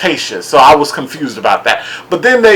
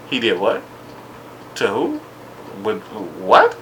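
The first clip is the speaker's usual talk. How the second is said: hushed disbelief; 5 semitones lower, 11 dB quieter than usual. Retold or imitated. Mock incredulous questions.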